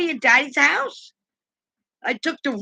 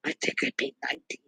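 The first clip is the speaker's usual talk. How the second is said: whispered aside; 8 dB quieter, faster than usual. Real, not acted. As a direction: whisper it quickly, a little confession. Confessing the real price aside.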